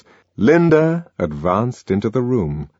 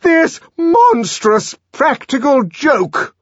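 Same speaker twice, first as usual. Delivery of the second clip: very high, loud, slow furious shout; the director shouting over the laughing room; 6 dB louder, slower than usual. A narrator's reading. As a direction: bellow your outrage. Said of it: "the director shouted".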